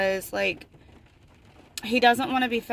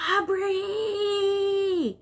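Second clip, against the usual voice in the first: much higher, crying yell of a name; angry yell; 10 semitones higher, 3 dB louder than usual. Retold or imitated. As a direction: wail her name like a hurt, furious kid. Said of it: "get mad at her and go".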